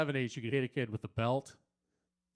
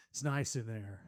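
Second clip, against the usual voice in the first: dreamy hush; 6 semitones lower, 8 dB quieter than usual. Acted. Dreamy praise of women's room.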